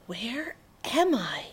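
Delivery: slow, soft whispered question. A narrator's reading. Sophie alone in a strange silent place, whispering. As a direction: whisper it into the dark. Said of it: "Sophie whispered".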